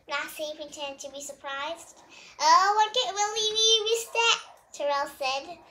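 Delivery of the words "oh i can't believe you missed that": high, loud playful tease; playful mock. A narrator's reading. Terrell teasing friends who missed it, playful. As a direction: tease them with a knowing wink.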